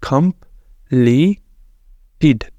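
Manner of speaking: कंप्लीटेड stretched out slowly, every syllable separate. Shown as a demonstration. Teacher sounds out the word slowly for students. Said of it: "Roman Urdu के अंदर ही".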